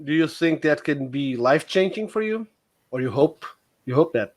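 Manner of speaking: ordinary talk, nothing marked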